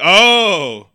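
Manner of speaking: long, loud, high-arching "oh"; dawning realization. Retold or imitated. Viewer finding out who wrote the movie, sudden realization. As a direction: swoop up big as it clicks.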